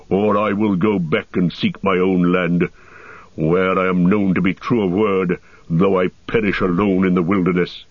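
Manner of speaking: dwarf voice, stubborn and defiant; obstinate dwarf Gimli. Acted. Dwarf defying the elf guard, stubborn dwarf voice. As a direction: plant your feet and refuse, as the dwarf.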